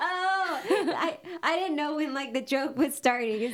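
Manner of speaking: high laughing realization. Real, not acted. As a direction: laugh through the words. Finally gets the joke.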